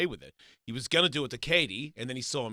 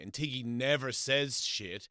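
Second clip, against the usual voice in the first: ordinary talk, nothing marked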